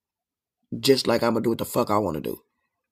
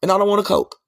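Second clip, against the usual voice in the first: louder, higher flat refusal; firm refusal; 6 semitones higher, 4 dB louder than usual. Retold or imitated. Refusing the Coke run, loud firm no. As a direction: say no to the store run loud and plain. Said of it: "But me, I be like".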